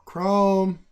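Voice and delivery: loud, high call of a name; loud call to a chat friend. Real, not acted. Calling out to a viewer.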